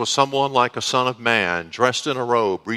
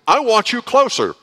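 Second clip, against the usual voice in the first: put-on clingy girlfriend; 11 semitones higher, 5 dB louder than usual. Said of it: "a girlfriend that I used to have".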